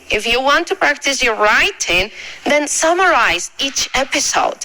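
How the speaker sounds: cada palabra en inglés dicha despacio y clara; inglés lento y claro. Acted. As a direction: lee el consejo en inglés como para alumnos. Tip dicho en inglés. (inglés pausado de profesora)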